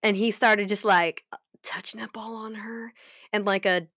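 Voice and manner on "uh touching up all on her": whispered. Real, not acted.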